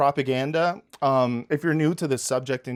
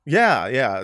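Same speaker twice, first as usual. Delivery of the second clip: ordinary talk, nothing marked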